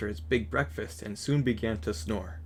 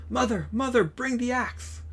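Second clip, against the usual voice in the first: higher, calling down to his mother; Jack yelling; 12 semitones higher than usual. A narrator's reading. Jack calling down to his mother for the axe, a higher yell. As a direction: shout for help from the beanstalk. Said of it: "he yelled".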